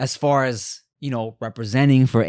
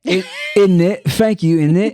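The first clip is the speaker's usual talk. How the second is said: put-on loud London accent innit; British accent; 3 semitones higher, 5 dB louder than usual. Showing off the accent, London innit. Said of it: "British accent".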